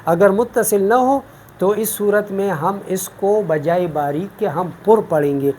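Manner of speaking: ordinary delivery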